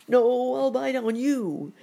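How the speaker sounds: high, loud, slow alligator wail; hammed-up gator cry. A narrator's reading. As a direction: howl the threat, stretched out. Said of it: "he cried to the lady bear".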